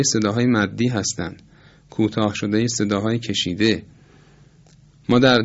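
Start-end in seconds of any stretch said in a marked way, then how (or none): none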